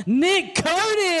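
drawn-out, big announcer build-up. Acted. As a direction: stretch the name like a ring announcer. Host announcing guest on stage.

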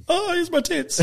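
high, soft mock-girl voice; imitated chat roulette girls. Co-host mimicking chat roulette chicks, high mock-girl voice. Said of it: "the most Australian sentence I could think of".

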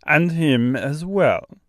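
put-on slow, thick Austrian-French accent; kindly bird in Austrian-French accent. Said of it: "strangely thick, almost Austrian accent".

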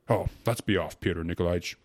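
loud, impatient plea to leave; exasperated interruption; impatient cry. A narrator's reading. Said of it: "cried the prosecuting attorney with an impatient jerk".

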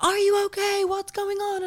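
very high concerned calling; imitating anxious neighbours across the way; worried neighbours calling. Neighbours across the street calling out, high and worried.

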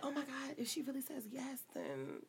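very soft, hushed realisation; nearly vanish, thinking aloud to yourself; inner thought. Retold or imitated. Woman reliving her worry about a yes, hushed. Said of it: "I was thinking like".

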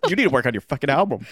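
loud, fast, laughing demand; pushy fan, laughing. Retold or imitated. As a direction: bark it through laughter.

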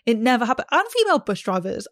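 ordinary delivery

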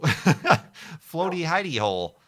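slow, laughing through the words. Real, not acted. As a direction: giggle the name out slowly. Laughing at name.

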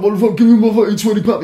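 put-on high, loud drunken shouting; drunken yell. Drunk man yelling after the thief who grabbed his cash, loud and high. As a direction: bellow it like a drunk robbed of cash.

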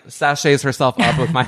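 normal talking voice

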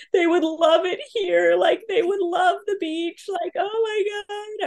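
put-on high, emotional retelling of crying for the kids; half-crying, missing them from far away; tearful longing. Mom on a trip, missing her kids, teary and high. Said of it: "I was just so emotional".

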